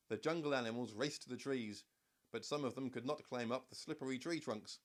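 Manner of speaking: plain reading voice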